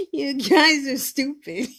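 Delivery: loud, high and slow through laughter; cracked up, fondly scolding friends; real helpless laughter breaking into the words; laughing hard. Real, not acted. Cracking up at viewers' comments.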